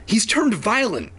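high-pitched urgent call to others; shouted alarm. A narrator's reading. Red-haired officer shouting to the others, high alarm. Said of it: "shout to the others".

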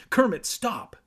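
high, loud urgent command; calling out. A narrator's reading. Evan tells cousin to stop spraying, calling out. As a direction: call out sharply to halt him. Evan calls out mid-fight.

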